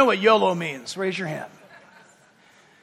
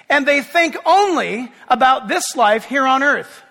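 loud, driving sermon delivery; full preaching; 2 semitones higher, 4 dB louder than usual. Acted. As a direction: preach it out to the whole church. Preaching opening to the congregation.

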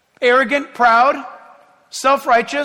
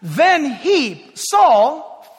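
normal talking voice

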